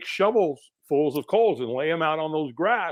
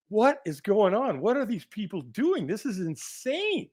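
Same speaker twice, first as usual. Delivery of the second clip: raised pitch, drawn-out disbelief; incredulous inner panic; 6 semitones higher, slower than usual. Acted. Firewalker watching people walk on coals, incredulous. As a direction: stretch the words in mock bewilderment. Your brain's going nuts.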